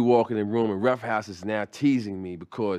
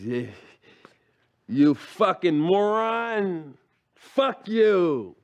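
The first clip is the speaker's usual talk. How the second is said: slow, drawn-out sneer, higher and louder; Daryl's insult; 7 semitones higher, 6 dB louder, much slower than usual. Retold or imitated. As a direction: drag out moron with contempt, then spit fuck you. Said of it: "he could say this like nobody else".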